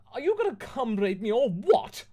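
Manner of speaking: hammed-up yell at Lupin. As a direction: scream it over the top. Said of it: "I yelled".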